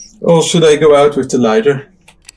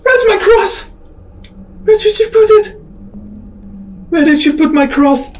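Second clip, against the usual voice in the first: very high, squealed questions; frightened, voice shot right up; panicked demand. Real, not acted. Panics over missing cross.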